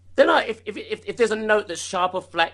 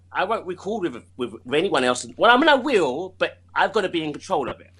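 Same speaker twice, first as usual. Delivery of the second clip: ordinary talk, nothing marked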